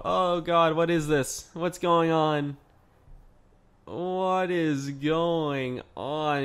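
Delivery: slowing into stunned confusion; baffled disbelief. Real, not acted. Baffled by an absurd match.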